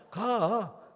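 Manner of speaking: high one-syllable Chungcheong intonation; short Chungcheong dialect. Shown as a demonstration. Pastor invites the asker along, terse Chungcheong accent. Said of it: "충청도 말이 제일 짧고 간단해요".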